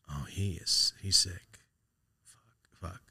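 stunned whisper to himself; hushed, voiceless dread; whispered realisation. Retold or imitated. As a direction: whisper it as it dawns on you. Private realisation friend is sick.

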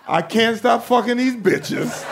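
slow line broken by laughter; laughing quote. Retold or imitated. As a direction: laugh through the fake sign. Mock homeless guy's sign.

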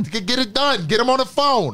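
loud high New York barking orders; hammed-up New Yorker demanding; New York accent. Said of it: "terrible New York accent".

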